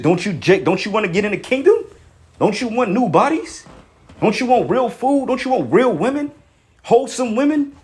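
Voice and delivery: urgent exhorting questions. Real, not acted. Exhorting listeners with rhetorical questions.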